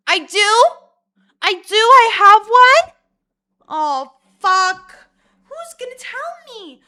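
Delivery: very high, loud feigned panic; mock shock. Told of her own deviated septum, mock-shocked squealing. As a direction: shriek it as sarcastic disbelief.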